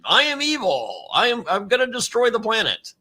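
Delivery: lightly voiced cartoon bad guy; hint of a gleeful evil villain. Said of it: "he's all in on".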